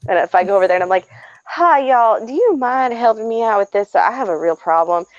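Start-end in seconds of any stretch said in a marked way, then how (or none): 1.5-5.1 put-on Southern accent. Asking strangers for help in a put-on Southern accent. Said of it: "I put a Southern accent on".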